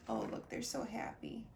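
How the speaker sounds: ordinary delivery, nothing marked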